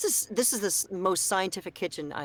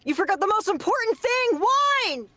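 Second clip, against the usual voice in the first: gasp, then much higher and louder; mock shock; 14 semitones higher, 4 dB louder, slower than usual. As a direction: act scandalised, rising to a cry. Gasping mock outrage.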